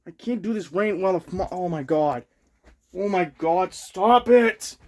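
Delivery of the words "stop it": sharp higher louder shout; yelling at a fly. Real, not acted. Fan ranting on video, fly interrupts him, shouts at it. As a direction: snap at the buzzing fly to stop.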